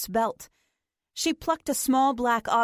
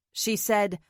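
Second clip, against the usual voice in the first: plain reading voice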